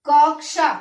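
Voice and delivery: कक्षा said loudly with a crisp क्ष; careful pronunciation. Shown as a demonstration. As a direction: model कक्षा for the class. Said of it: "सही बोला जा रहा है ना".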